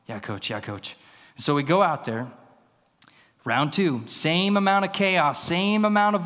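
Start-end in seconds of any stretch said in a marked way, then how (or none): 0.1-1.0 low, soft, quick-to-agree reply; meek acknowledgement. Retold or imitated. Young wrestler answering his coach, meek and quiet. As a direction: imitate an obedient kid under the breath.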